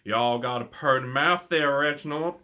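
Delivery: slow, loud Southern backwoods drawl; exaggerated rural Southern US accent, drawn out; mock Deliverance hillbilly. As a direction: put on a creepy American hillbilly accent. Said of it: "the Deliverance moment".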